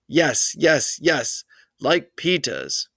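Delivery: put-on insistent childish yes yes yes, slow on Peta's; a toddler insisting on his brother's loafers; toddler hollering. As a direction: demand it like a stubborn little boy. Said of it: "Fudge hollered".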